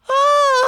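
loud, very high panicked shriek; shrieking long cry; high parrot scream. A narrator's reading. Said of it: "зарепетував папуга".

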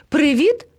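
high, bright-pitched hail, rising; calling out. A narrator's reading. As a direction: call out a greeting to others. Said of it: "гукнув до них". (гукає високо, вітаючись)

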